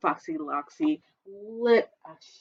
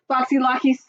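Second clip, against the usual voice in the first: usual speaking voice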